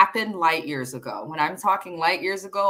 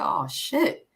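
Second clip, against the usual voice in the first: breathy stage whisper of shock; whispered oh shit. Retold or imitated. Whispering to the co-host after a brutal line, shocked. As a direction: hush it like you just saw drama. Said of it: "I said".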